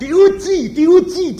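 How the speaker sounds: each diuji hit high and loud, falling; emphatic repetition. Real, not acted. Preacher to congregation, diuji punched high and loud. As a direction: hammer diuji twice, climbing.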